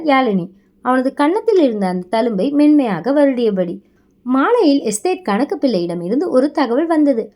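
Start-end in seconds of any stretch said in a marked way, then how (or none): none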